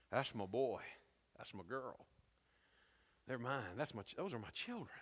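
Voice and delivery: low, soft, proud father's voice; a low, tender voice of a proud father. Pretend. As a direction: murmur it like a proud dad looking at his kids.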